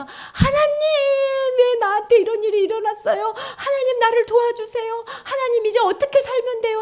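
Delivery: high, loud tearful pleading; 간절한 울부짖음. Acted. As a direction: plead with God in an acted wail. Acted desperate prayer of true believer. (높고 크게 울먹이며 매달리는 기도)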